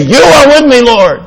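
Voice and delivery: a carried-away believer crying out to the Lord, high and loud; enthusiastic. Acted. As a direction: let it burst out of you. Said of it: "you get enthusiastic and you say".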